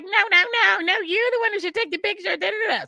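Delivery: lightly voiced celebrity insisting. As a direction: pitch way up like a star waving you in. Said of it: "But she's like".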